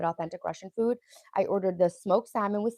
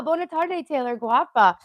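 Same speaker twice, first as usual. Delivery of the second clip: bright high singsong hello; a much higher, louder playful welcome; playful greeting; 6 semitones higher, 7 dB louder than usual. Real, not acted. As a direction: greet the chat in a sunny foreign lilt. Playful foreign greeting to chat.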